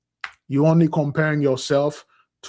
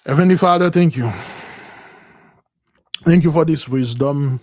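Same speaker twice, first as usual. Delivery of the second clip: loud, earnest prayer to the Heavenly Father; 6 dB louder than usual. Real, not acted. Preacher opens a prayer to the Heavenly Father, loud and earnest. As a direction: call out to God in thanks.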